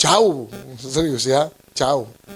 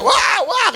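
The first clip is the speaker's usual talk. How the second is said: very high-pitched imitated yelp; mock scream. Ion entering a cold room after bathing, high mock scream. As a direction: squeal it like a shock of cold. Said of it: "teriak-teriak".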